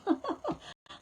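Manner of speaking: sudden high breathy giggle; a quick rueful laugh up high. Real, not acted. Medium realising she caught a sore throat, high giggle.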